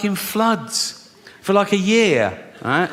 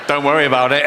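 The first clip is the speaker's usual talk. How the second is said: smug; 3 semitones lower, faster than usual. Retold or imitated. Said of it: "he's all smug then, and he's going".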